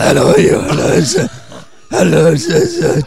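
lightly voiced politician greeting; a hint of Helen Zille, breathy hellos; Helen Zille impression. Puppet mimics the premier greeting people. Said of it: "it sounds like Helen Zille's".

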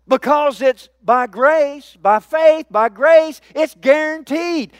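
building sermon climax; full-force preaching. Acted. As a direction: drive each phrase home to the church.

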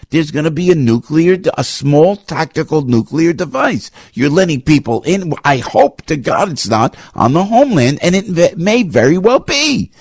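pleading alarm, pitch way up; fired up. Real, not acted. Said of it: "forgive me for being so fired up this morning".

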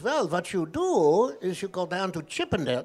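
put-on lilting Yiddish-accented advice. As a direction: joke rabbi with a Yiddish accent. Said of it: "This is my Yiddish accent".

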